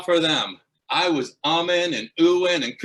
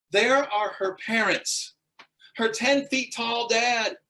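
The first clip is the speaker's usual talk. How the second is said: usual speaking voice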